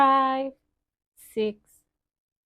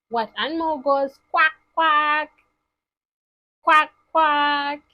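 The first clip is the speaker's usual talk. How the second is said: playful, louder duck quacks; quacking; 2 semitones higher, 6 dB louder, faster than usual. Pretend. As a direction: quack it out for the kids. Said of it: "imitating duck for children".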